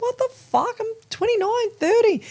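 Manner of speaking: high, shocked disbelief; high-pitched panicked disbelief; shocked outburst. Retold or imitated. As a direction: squeak it out in horror. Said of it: "And I'm like".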